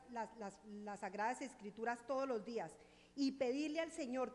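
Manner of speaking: loud, full-force preaching. Real, not acted. Preacher tells the congregation, preaching loudly. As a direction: drive the testimony home to the church.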